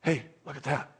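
soft, low aside; a quiet prompt, dropped in low; Holy Spirit's nudge. Acted. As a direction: quietly point something out. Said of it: "he's gonna go".

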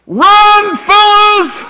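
high, loud mock shout to flee. Jesus mockingly calling his captors to run, loud shout. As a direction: yell it like a panicked soldier. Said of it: "Does he go".

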